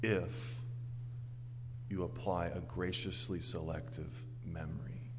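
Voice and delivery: ordinary delivery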